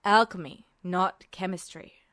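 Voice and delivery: as if it should be obvious; a touch of weary patience; stating the obvious. A narrator's reading. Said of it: "as though it should have been obvious".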